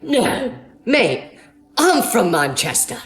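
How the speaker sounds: imitated British accent, last phrase drawn out. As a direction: answer as a surprised Brit in a mock British accent. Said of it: "Something about British accents".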